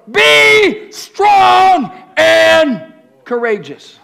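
high, slow, full shout; shouted command. Acted. Preacher shouting God's charge at the room. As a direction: bellow each word.

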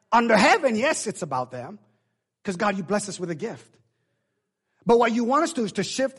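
loud, high preaching bursts; emphatic preaching. Real, not acted. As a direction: drive each point home to the congregation.

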